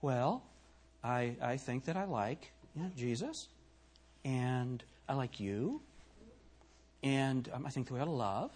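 very soft, hesitant, halting delivery; timid, hushed caricature of a sissy; mock effeminate man. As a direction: mince softly through it, pausing between phrases. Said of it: "he talks like this".

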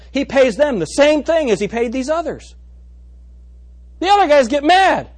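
animated preaching. Acted. Preacher retelling the parable to the church, loud and lively. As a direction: drive the parable home.

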